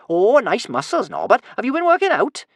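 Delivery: high, loud mock admiration; teasing. A narrator's reading. Kamikaze tickling Norbert under the armpits, high teasing. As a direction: coo loudly in mock flattery.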